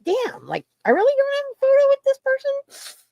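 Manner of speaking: high, squeaky mock disbelief. Missing a photo with someone, high squeaky mock disbelief. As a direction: rise way up in pretend shock. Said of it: "and I'm like".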